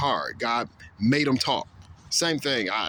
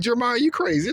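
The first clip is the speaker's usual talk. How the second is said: mocking crowd; 11 semitones higher than usual. Crowd jeering at Jeremiah, high mocking voice. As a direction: scoff at him like the crowd.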